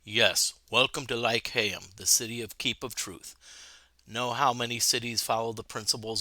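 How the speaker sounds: low, hushed sage voice, calm and patient; a quiet old sage who teaches the way of truth; wise city sage. Acted. Reads game character dialogue.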